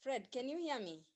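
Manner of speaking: barely audible, far from the mic; weak, distant voice on a bad line; faint voice. Real, not acted. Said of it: "the voice is a bit faint".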